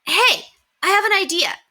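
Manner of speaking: bursting with a plan; Henry excited about the club; enthusiastic. Acted. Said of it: "Henry was enthusiastic".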